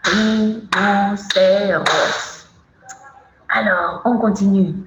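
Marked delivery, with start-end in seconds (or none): 0.0-1.8 "Rhinocéros" slowly, syllable by syllable; drawn-out syllables. Shown as a demonstration. Teacher showing children a long word, syllable by syllable. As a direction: stretch out each syllable of "Rhinocéros". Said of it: "Oula, c'est long".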